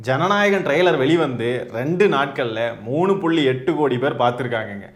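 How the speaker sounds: ordinary talk, nothing marked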